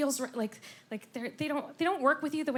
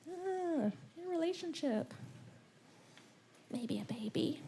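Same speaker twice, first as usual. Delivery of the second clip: hinting mom; 5 semitones higher, 10 dB quieter than usual. Retold or imitated. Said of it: "My mom is like".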